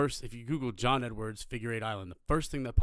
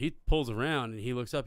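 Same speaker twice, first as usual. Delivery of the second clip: ordinary talk, nothing marked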